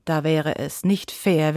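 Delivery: ordinary delivery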